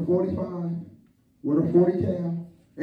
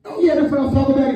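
fast, higher, covering up; fast, pitched-up brush-off; mock disappointed friend; 4 semitones higher, 3 dB louder, much faster than usual. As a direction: rush it out, playing it off. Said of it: "He said".

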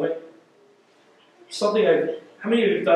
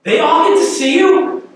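higher, louder indignant question; ticked off; 8 semitones higher, 6 dB louder than usual. Retold or imitated. Thomas complaining to Jesus, indignant. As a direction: Thomas protesting, stung and resentful. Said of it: "a little ticked off at Jesus".

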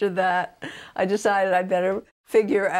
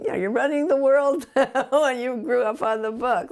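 laughing; 7 semitones higher, 4 dB louder than usual. Real, not acted. Laughs while telling reporter.

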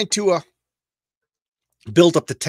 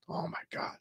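breathless whisper of disbelief; awed whisper; 14 dB quieter than usual. Real, not acted. Seeing his childhood town in an old ad, a hushed whisper. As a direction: whisper it, stunned by the memory.

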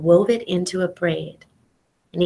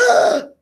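shocked grunt; 11 semitones higher, 4 dB louder than usual. Retold or imitated. After gasping at thrown rock.

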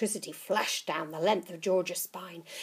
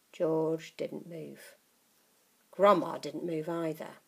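ordinary delivery

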